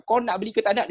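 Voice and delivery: high, pushy question; rude intonation. Shown as a demonstration. Teacher mocks asking a client this way, rude and high. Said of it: "saya tak jumpa lagi lah kita cakap".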